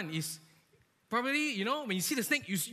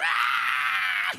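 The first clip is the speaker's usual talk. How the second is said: retold scream; 7 semitones lower, 10 dB louder than usual. Big friend shrieking at a flying cockroach, mock scream. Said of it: "he was screaming like that".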